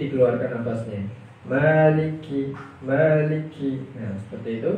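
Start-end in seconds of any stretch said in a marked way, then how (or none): 1.5-3.9 maliki with a breath escaping on the k; breathy kaf. Shown as a demonstration. Teacher demonstrating the breathy kaf. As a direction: let a little air out on the kaf of maliki. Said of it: "sedikit keluar nafasnya".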